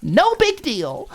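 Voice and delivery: mocking indifference; mock shrug. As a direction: toss it off sarcastically. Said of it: "Maud shrugged".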